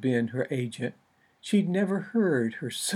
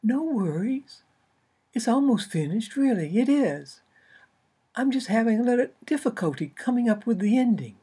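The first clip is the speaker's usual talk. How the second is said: author voice; 8 semitones higher than usual. Acted. Author's voice, placating agent.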